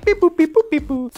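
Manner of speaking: high chirpy robot beeping; robot beeps. Pretend. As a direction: beep like a little robot. Hands off to video game news.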